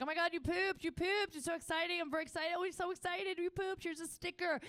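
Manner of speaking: high gushing mock excitement for the toddler; over-the-top praise. Mom praising her potty-training toddler, gushing high and bright. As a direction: cheer the potty win like a sticker-giving mom. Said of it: "trying to be very positive about it".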